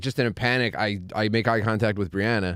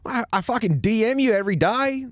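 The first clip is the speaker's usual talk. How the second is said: indignant fan; 12 semitones higher than usual. Retold or imitated. Quoting angry fan.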